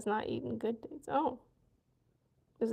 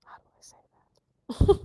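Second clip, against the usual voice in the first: whispering while figuring it out; whispered self-question; 7 dB louder than usual. Real, not acted. Asking self how to say the word, whispered. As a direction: ask yourself in a whisper. Wondering aloud how to pronounce.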